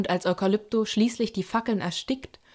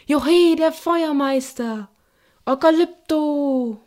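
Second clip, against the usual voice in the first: higher, louder, slow jubilant cheer; 6 semitones higher, 6 dB louder, slower than usual. Acted. Audience cheering Eukalypto, jubilant. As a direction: hail the fire master.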